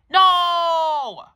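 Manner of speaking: put-on high, loud shrieking child's no; a child screaming in refusal; kid's shrieked no. Kid refuses dad being called.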